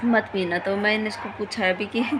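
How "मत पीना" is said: usual speaking voice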